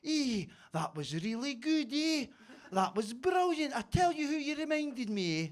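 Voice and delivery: put-on slow, drawn-out Aberdeen woman's accent; high, singsong broad Aberdeen impression. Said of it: "broad Aberdeen accent and says".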